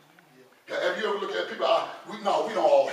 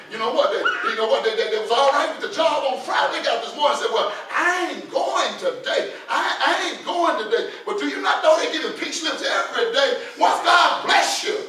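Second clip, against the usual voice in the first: full-force preaching; 8 semitones higher, 8 dB louder than usual. Acted. Preacher rebuking those who forget God, full-force preaching. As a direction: drive it home to the congregation, loudly.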